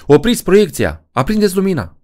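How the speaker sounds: panicked yell. Acted. Helen screams at the cinema to stop the film, in panic. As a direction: yell for the lights, rising in panic. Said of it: "urlă ea".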